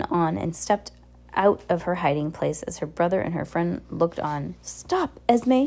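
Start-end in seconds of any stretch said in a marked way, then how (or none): 4.6-5.7 high-pitched urgent cry. A narrator's reading. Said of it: "she cried".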